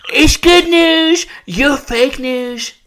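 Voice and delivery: Trump impression. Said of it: "My Trump impression is slipping".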